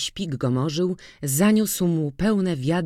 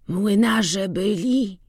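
slow weak question; 6 semitones higher, slower than usual. A narrator's reading. Sick Kuba asks slowly.

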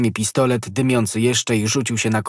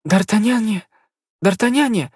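high, louder cry of his name; desperate calling; 12 semitones higher, 4 dB louder than usual. A narrator's reading. Poisoned Konstancja calls for D'Artagnan, desperate. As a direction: call him desperately from her deathbed. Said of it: "zawołała pani Bonancier".

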